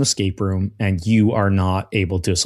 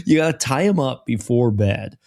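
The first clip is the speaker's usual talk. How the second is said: ordinary talk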